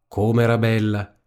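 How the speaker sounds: ordinary delivery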